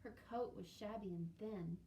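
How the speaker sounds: usual speaking voice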